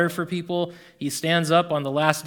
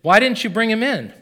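higher, louder demanding question; 7 semitones higher, 8 dB louder than usual. A narrator's reading. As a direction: press them hard for an answer. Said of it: "the leading priests and Pharisees demanded".

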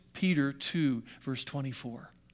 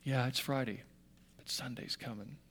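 soft quote; 6 semitones lower, 12 dB quieter than usual. Retold or imitated. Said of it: "the way Tony Campolo said it".

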